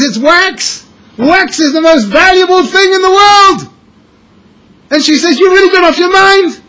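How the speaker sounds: high, loud exasperated wife voice; scolding wife. Acted. Wife telling her husband he has lost his mind, high shrill scolding. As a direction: berate him like an incredulous wife.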